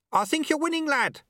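very high-pitched shout. Acted. Sweeper shouting to lad on the podium, shouting. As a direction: call up to the far podium. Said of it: "he shouted to the figure on the podium".

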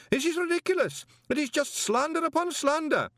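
panicked banker shouting; shouted order. Acted. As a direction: shout to stop the reading. Greeningham shouting on.

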